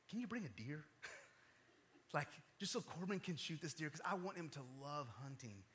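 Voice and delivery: soft, low-key pleading with God; hushed prayer. Retold or imitated. Dad privately asking God for a deer, hushed pleading. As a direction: pray quietly, a little sheepishly, as if asking a favor. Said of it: "I'm just praying".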